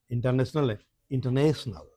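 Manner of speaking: इंटरनेशनल with a light ट and a stressed ने; correct pronunciation. Shown as a demonstration. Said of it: "ट कम बोलना है, ने ज्यादा बोलना है". (इंटरनेशनल, ट हल्का और ने पर ज़ोर)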